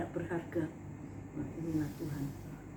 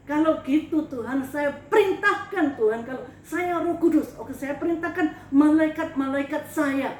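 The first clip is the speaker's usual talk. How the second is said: louder, demanding God send her angels; commanding challenge; 6 dB louder than usual. Retold or imitated. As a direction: order heaven around, loud and defiant. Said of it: "Saya tantang Tuhan begitu".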